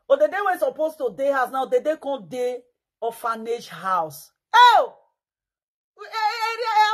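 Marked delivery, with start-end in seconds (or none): 4.5-6.9 a loud, high, startled "Oh!"; sudden loud shock; shocked cry. Real, not acted. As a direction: gasp it out in shock. Shocked exclamation.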